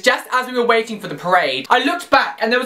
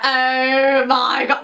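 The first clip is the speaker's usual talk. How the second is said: drawn-out, higher-pitched amazement; starstruck awe; 4 semitones higher, much slower than usual. Retold or imitated. Recognising TV show faces in the crowd, starstruck awe. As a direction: stretch it out, reenacting starstruck shock. Retold starstruck reaction on seeing them.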